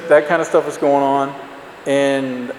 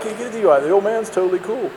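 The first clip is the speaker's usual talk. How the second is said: higher, mock swaggering boast; cocky drunk brag; 7 semitones higher, faster than usual. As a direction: brag like a careless drunk kid. Cocky drunk bragging to friends.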